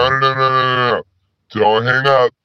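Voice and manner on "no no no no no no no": pitched-down disguised voice; disguised deep voice. Real, not acted. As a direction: voice digitally pitched down. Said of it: "I pitched my voice down".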